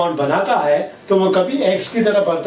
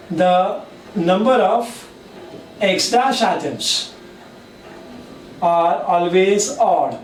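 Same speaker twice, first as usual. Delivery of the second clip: slow, spaced-out dictation; teacher dictating the rule to students; much slower than usual. Shown as a demonstration. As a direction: say each phrase slowly for note-taking. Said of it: "teacher dictating the rule".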